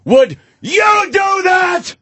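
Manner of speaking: high slow shout at the jury; yelling lawyer. Retold or imitated. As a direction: yell it like a lawyer. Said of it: "And they yell".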